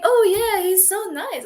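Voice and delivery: retelling how sweet she thought he was; gushing. Retold or imitated. Retells her own reaction to him.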